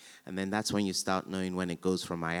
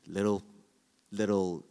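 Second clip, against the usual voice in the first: ordinary delivery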